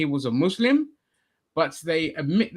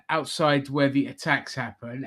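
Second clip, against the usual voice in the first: ordinary talk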